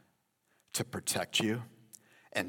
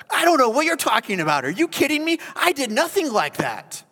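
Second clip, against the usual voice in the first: much higher, loud and fast denying; flat denial; 8 dB louder, faster than usual. Pretend. David denying his sin, loud high indignant denial. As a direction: deny it all, outraged. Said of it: "Deny, deny, deny".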